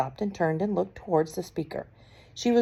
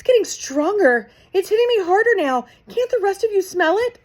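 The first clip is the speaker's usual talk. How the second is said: much higher, loud excited witch; shrill witch; 13 semitones higher, 7 dB louder than usual. A narrator's reading. Mildred urges the witches to smell it, high and loud. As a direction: sniff and insist, rising.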